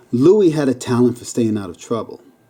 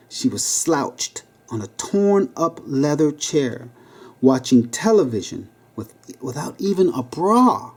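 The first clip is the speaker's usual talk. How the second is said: ordinary delivery, nothing marked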